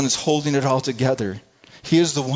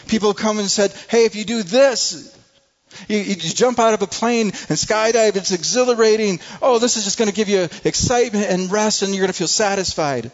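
raised, loud, urgent sermon voice; preaching at full force; 6 semitones higher, 4 dB louder than usual. Acted. Pastor urging the congregation to come, loud impassioned preaching. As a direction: preach it out, pleading.

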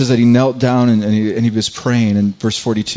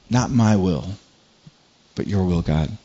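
soft surrender; 4 semitones lower, 6 dB quieter than usual. Retold or imitated. Jesus surrendering in prayer.

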